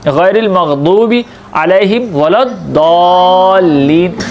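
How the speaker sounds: slow recitation with dad sound; recited Quran verse. Shown as a demonstration. Teacher recites to students, slow and careful. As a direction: chant the verse slowly, Arabic dad. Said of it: "सूरह फातिहा के अंदर दो बार ये हर्फ आता है".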